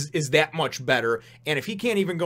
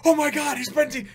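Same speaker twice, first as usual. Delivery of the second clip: much higher, louder panicked cry; mock horrified shriek; 13 semitones higher, 6 dB louder than usual. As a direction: imitate a girl shrieking in shock. Acts girl finding apparently severed head.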